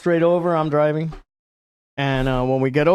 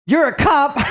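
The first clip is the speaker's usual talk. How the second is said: high, incredulous cartoon trooper; mock a blustering lawman in disbelief; hammed-up raised-pitch astonishment; Foghorn Leghorn; 10 semitones higher, 4 dB louder than usual. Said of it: "he's Foghorn Leghorn as a human".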